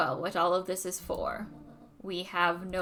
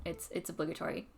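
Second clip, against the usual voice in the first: ordinary talk, nothing marked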